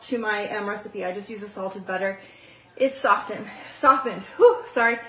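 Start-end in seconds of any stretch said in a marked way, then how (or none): 4.3-5.0 breathless apology after the stairs; out of breath. Real, not acted. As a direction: still winded, puffing out a whew. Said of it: "I ran down the stairs and ran back up".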